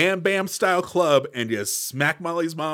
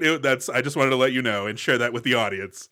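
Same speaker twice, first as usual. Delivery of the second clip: ordinary talk, nothing marked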